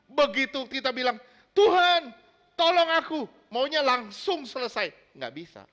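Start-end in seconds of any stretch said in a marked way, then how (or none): 1.6-3.2 a loud, higher cry of "Tuhan"; mock plea. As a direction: call out to God, wanting it now. Impatient prayer quoted.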